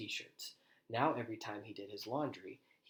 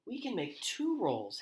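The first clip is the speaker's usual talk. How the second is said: high, loud, bouncing voice; excited jumping; 10 semitones higher, 6 dB louder than usual. A narrator's reading. Henrietta jumping up and down, high and loud with excitement. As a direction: blurt it out while jumping. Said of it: "jumping up and down".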